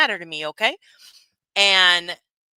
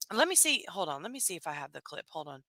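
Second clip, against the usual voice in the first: muttering; 6 semitones lower, 9 dB quieter than usual. Real, not acted. Searching phone, muttering to self.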